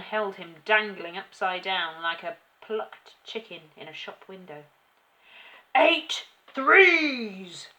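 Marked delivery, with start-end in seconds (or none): 5.7-7.7 very loud, high drawn-out shout; furious headmistress roar. A narrator's reading. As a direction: bellow it, stretching the last word. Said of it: "the Trunchbull shouted".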